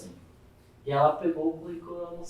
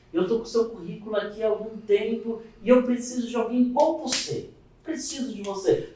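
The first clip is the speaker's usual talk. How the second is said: higher-pitched, insistent phone pitch; imitated keen caller; lightly voiced eager recruiter; 7 semitones higher than usual. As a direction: plead for the candidate to take the job. School caller eagerly recruiting him.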